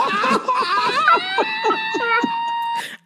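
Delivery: high shrieking laughter. Real, not acted. Comic cracking up over the wife's eyes, shrieking laugh. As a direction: scream with laughter.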